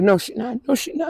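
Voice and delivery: soft, high, pleading retelling; tearful denial. Retold or imitated. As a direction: voice rising as it gives out. Retells father's denial, in tears.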